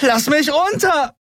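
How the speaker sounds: slow, very high outraged yell; high, drawn-out demand; screamed protest. A narrator's reading. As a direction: scream to be let go. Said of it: "schrie sie und trommelte".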